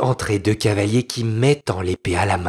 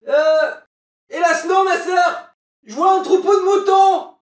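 very high, loud calling; sister on lookout; 4 dB louder than usual. Acted. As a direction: shout the news down. Sister calls bad news down.

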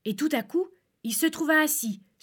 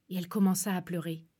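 plain reading voice

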